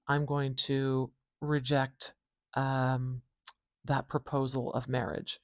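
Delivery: normal talking voice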